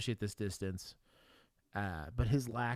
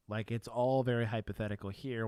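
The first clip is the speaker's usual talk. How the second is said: ordinary talk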